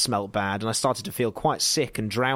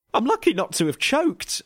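indignant retort; 12 semitones higher, 5 dB louder than usual. A narrator's reading. Narrator retorts, breathless.

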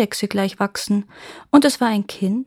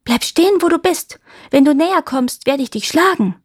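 high, loud threatening call; raised girl's voice, commanding; 7 semitones higher, 5 dB louder than usual. A narrator's reading. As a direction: shout a warning from the wall. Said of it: "rief wieder".

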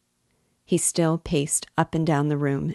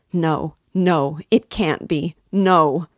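louder, rising cry of desperation; desperate cry; 7 dB louder, slower than usual. A narrator's reading. Sonia refusing Raskolnikov's words, crying out in desperation. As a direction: cry out as if stabbed. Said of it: "Sonia cried aloud in desperation".

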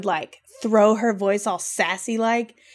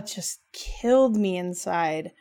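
normal talking voice

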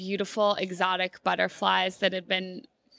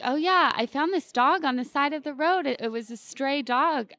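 higher, bright casual stranger; breezy stranger; 7 semitones higher, 3 dB louder than usual. Retold or imitated. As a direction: breeze through it like a carefree stranger. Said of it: "she was like".

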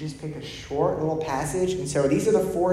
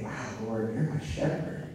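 whispered, softer prayer to God; hushed prayer; 4 semitones lower, 6 dB quieter than usual. Shown as a demonstration. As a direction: breathe it like a private prayer. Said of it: "I would read that and say".

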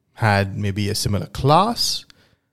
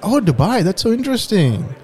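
Anna's voice; 11 semitones higher than usual. Acted. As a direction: play shy Anna, pitched up. Acted dialogue, Anna voice.

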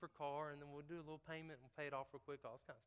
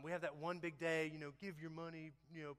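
ordinary delivery

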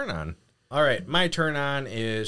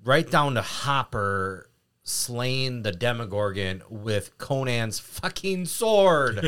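normal talking voice

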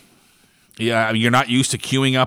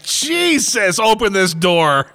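excited plea; 9 semitones higher, much slower than usual. Retold or imitated. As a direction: beg them to open up, half laughing. Eager customer begging to enter.

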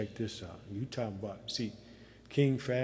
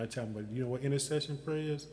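ordinary delivery

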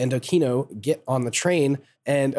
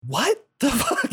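high incredulous; 10 semitones higher, slower than usual. Real, not acted. Reacting to nanomachines line.

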